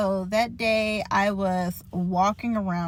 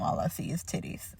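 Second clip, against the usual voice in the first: a sly, much softer whisper; whispered punchline; 3 semitones lower, 10 dB quieter than usual. Retold or imitated. Joking to the nurse about her chest, in a whisper. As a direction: drop to a whisper for the cheeky punchline.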